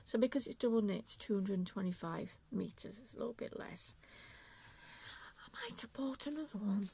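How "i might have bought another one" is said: conspiratorial whisper, as if admitting a secret; guilty whisper. Real, not acted. Knitter confesses an extra purchase to viewers in a guilty whisper.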